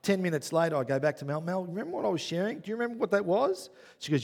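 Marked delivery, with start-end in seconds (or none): 1.4-3.7 higher, pleading self-mockery; sheepish asking. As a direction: sheepishly ask for help remembering. Asking wife, forgot revelation.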